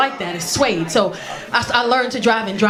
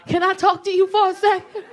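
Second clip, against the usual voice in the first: high, careful request; buttering up a boyfriend; tentative sweet-talk; 7 semitones higher than usual. Acted. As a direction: ask timidly in a raised voice. Timid approach to boyfriend.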